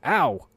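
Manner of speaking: sharp high yelp of pain. Real, not acted. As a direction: yelp as she pinches. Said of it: "I yelped when she started pinching".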